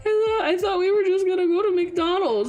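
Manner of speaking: high, squeaky, laughed-out voice; real laughter still in the voice; weak from laughing. Real, not acted. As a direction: squeeze the words out after a laughing fit. Recovering from laughing fit.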